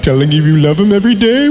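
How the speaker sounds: normal talking voice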